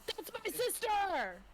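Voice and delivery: high, slow proud shout; proud cheer. Acted. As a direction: cheer her on from the sidelines. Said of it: "I'm like".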